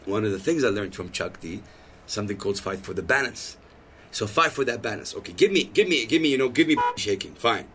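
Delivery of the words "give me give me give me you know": ordinary delivery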